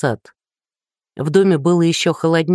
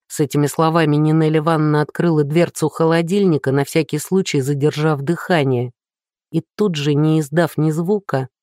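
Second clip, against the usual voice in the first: plain reading voice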